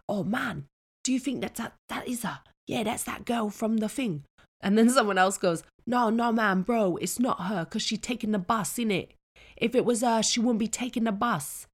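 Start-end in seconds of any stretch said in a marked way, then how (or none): 0.1-4.2 put-on higher-pitched teenage lads' voices; mock lads chatting on the bus, pitched up. Lads on the bus wondering if she's famous, mock youth voices. 5.9-11.7 higher, louder London youth voice; mock lad arguing it's not her; lad on the bus. One lad telling his mates it can't be her, hammed-up youth voice.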